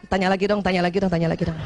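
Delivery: low pleading under the breath, acted; inner voice. Wife silently begging husband to ask, low imitated inner voice. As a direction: lightly mimic a secret repeated plea. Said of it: "Dalam hati masih juga".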